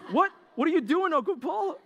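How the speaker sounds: high-pitched mock outrage; high, incredulous complaint; indignant protest. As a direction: squeal in comic disbelief at an uncle. Acted outburst at uncle taking dessert.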